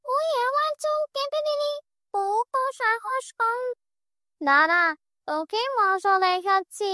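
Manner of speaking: high shrieking ghoul threat; shrieking witch. Acted. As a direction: shriek with rage at the cut hair. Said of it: "চিৎকার করে বলে উঠল".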